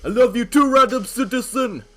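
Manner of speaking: put-on cocky hype kid. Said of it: "I'm like".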